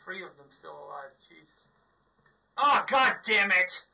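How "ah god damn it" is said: loud, slow, drawn-out furious outburst; angry yell. Real, not acted. Gamer yelling at his screen after being killed. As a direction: bellow it out at the screen.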